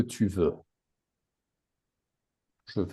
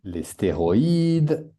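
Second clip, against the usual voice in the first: slow model word; slower than usual. Shown as a demonstration. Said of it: "teacher models word slowly".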